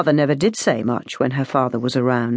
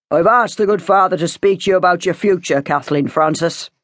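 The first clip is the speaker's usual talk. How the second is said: mildly sharp, stern father; a touch of anger behind formality; 2 semitones higher than usual. A narrator's reading. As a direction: announce it formally, with an edge. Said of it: "he'd said it sharply".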